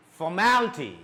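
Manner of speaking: formality with MAL pushed up, falling on ty; stress on MAL. Shown as a demonstration. Teacher drilling words ending in T Y, stress on MAL. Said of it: "a raise of your voice".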